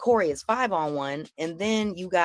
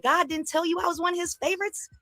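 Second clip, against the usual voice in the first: much higher, quick mock-incredulous questions; retold taunting comeback; 12 semitones higher, faster than usual. Woman retells her comeback to the comedian, high-pitched taunting. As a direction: replay a sassy comeback in a high voice.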